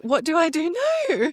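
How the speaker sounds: high, loud wail of dismay; dramatic despair. Retold or imitated. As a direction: cry out in mock despair. Despair after finale.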